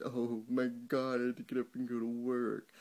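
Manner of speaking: sarcastic impression of staff griping; mock whiny worker. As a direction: whine like a bored employee. Mocking whiny Apple staff.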